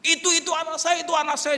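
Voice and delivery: high, loud, urgent repetition; frantic recognition. Acted. Father spotting his child upstairs, frantic cry. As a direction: blurt it out desperately.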